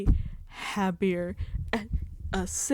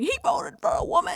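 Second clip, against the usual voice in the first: much higher, louder disbelieving squeak; shocked squeal; 4 dB louder than usual. Retold or imitated. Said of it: "My jaw dropped".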